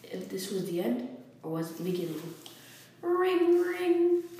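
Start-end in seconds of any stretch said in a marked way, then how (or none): 3.0-4.2 loud high doorbell imitation; doorbell ring. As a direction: blare "Ring, ring" like a bell, much higher and louder. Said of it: "blared the doorbell".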